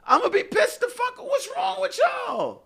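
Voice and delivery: real shouted frustration at the women listening; exasperated shout. Real, not acted. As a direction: blow up at them in real frustration. Ranting about partners not understanding.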